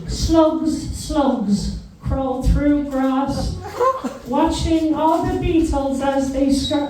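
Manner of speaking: put-on high, slow sing-song child recital; a hammed-up little kid reciting verse; kid reciting poem. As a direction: chant it like a child performing a poem. Kid recites drama piece.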